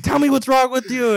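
high, loud retold demand; mom freaking out. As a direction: imitate an angry mother at the end of her patience. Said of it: "she freaks out more".

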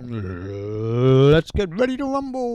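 slow, drawn-out boxing announcer call; slow booming ring announcer. Pretend. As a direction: stretch it like a fight-night MC. Announcer catchphrase to open show.

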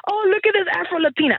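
high, bright mock gushing; exaggerated pointing-out voice, imitating what she avoids saying; hypothetical gushing announcement. Teacher mocking a line she never said to students, high mock gush. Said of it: "I didn't say".

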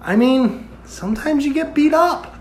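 high, soft offhand shrug; big brother shrugging off a beating; imitating brother. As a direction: say it like nothing happened. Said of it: "you were just like".

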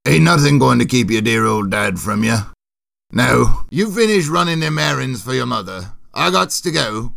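put-on rough-and-ready old soldier talking to his son; Boris, fond working-class dad. Soldier father promising his son he'll stay, fond character voice. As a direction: play a folksy father with dropped grammar.

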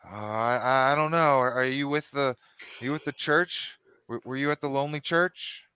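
a suspicious father questioning a stranger; wary father. Acted.

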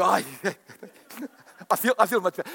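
laughing fake relief; laughing mock relief. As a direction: chuckle through it. Laughing mock relief after hands raised.

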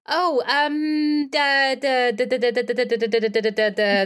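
flustered, rapid-fire stutter; startled. Acted. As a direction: stammer on one syllable, caught off guard. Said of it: "he looks startled and goes".

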